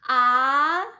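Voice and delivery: "ah" gliding upward, loud; rising second tone. Shown as a demonstration. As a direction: Mandarin second tone on "ah", climbing. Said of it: "it's rising tone".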